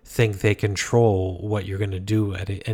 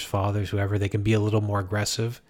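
normal talking voice

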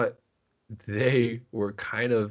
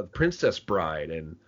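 usual speaking voice

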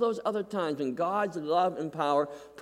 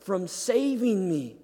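slow, higher, drawn-out plea; anguished lament; 5 semitones higher, 4 dB louder, slower than usual. Acted. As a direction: stretch each word like a groan of pain. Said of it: "where he says".

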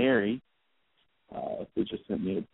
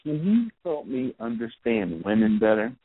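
higher, eager voice for the man; lightly voiced man, pitched up and hopeful; hopeful man asking; 8 semitones higher than usual. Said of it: "The guy goes".

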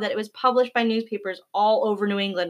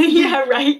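laughing, louder and higher; 6 semitones higher, 8 dB louder than usual. Real, not acted. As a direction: say it through a real laugh. Laughing at shared nerves.

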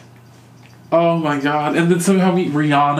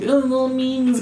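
mocking gushy voice; 9 semitones higher than usual. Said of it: "That's, like, so".

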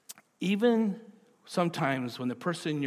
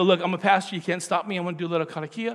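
playful announcement; 3 semitones higher, 7 dB louder, much faster than usual. Retold or imitated. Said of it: "And then I go".

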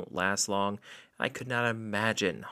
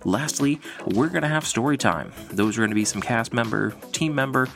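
ringmaster; 6 dB louder than usual. Acted. Podcast intro, showman welcome.

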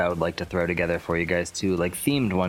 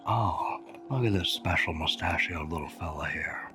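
hushed, cooing to a little creature; soft whisper; 3 semitones lower, 4 dB quieter than usual. Acted. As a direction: whisper it tenderly like to a pet. [name] voices Baxter cradling Angel.

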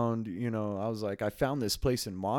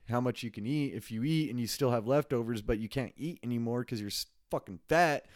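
ordinary talk, nothing marked

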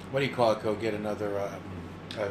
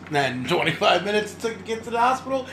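normal talking voice

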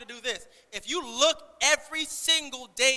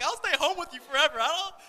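high, loud mock blubbering; caving-in dad; 7 semitones higher, 6 dB louder than usual. Father giving in to a pleading daughter, hammed-up blubbering. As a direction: give in with a hammed-up high wobble. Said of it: "I was like".

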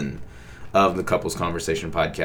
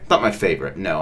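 ordinary talk, nothing marked

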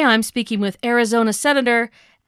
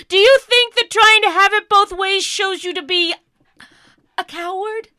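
angry accusation; 9 semitones higher, 5 dB louder than usual. Acted. Interviewer attacks senator again.

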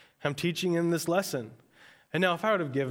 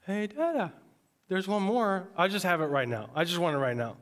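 put-on pitched-up childish wheedling; whiny toddler begging; 5 semitones higher than usual. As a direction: beg like a three-year-old who wants it now.

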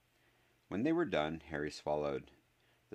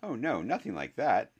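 hurried, higher and louder denial; hasty; 7 semitones higher, 6 dB louder than usual. A narrator's reading. Harry hurrying to reassure an apprehensive Neville, hasty. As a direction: jump in quickly to reassure. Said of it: "Harry said hastily".

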